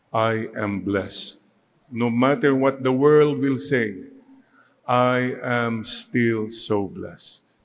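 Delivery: each word drawn out slowly; a slow, deliberate declaration of being blessed; slow affirmation. Shown as a demonstration. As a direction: let every word of the blessing land, slowly. Congregation affirmation, spoken slowly.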